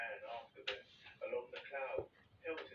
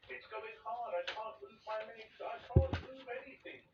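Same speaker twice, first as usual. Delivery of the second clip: high, rising, loud panic; 10 semitones higher, 7 dB louder than usual. A narrator's reading. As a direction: let the panic climb to a cry. Centipede panicking, stuck.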